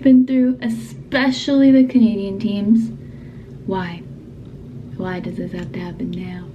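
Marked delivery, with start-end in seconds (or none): 3.7-6.5 whispering. Real, not acted. Frustrated whispered question.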